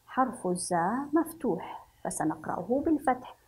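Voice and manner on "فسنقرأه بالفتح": ordinary talk, nothing marked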